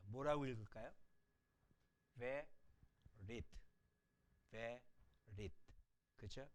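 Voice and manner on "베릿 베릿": "베릿" very slow and soft, "베" apart from "릿"; weak shewa reading. Shown as a demonstration. Teacher answers how to read it, "베릿" slow and low. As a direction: sound out "베릿" slowly with a light "베". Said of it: "이것도 역시 한음절 단어입니다".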